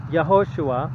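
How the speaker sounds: "Yehoshua" said as yeh-ho-SHOO-ah; Hebrew pronunciation. Shown as a demonstration. Teacher giving the Hebrew form of Joshua, careful pronunciation. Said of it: "which in Hebrew would be".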